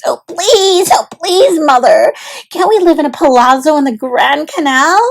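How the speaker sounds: high, loud pleading; edge of hysteria. Acted. Olivia begging her mother for a palazzo, hysterical pleading. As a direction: beg with near hysteria. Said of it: "with an edge of hysteria in her voice".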